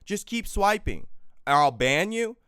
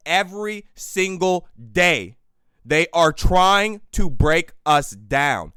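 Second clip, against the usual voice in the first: louder, slow and hammered out word by word; slow angry yell; 7 dB louder, slower than usual. Real, not acted. Ranting vlogger yelling slowly at those trying to break them. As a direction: drag it out and pound every word. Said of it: "Maybe yelling at the microphone".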